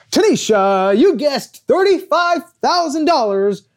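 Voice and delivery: slow, high, drawn-out host reveal; game show host. Retold or imitated. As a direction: build suspense like a TV host.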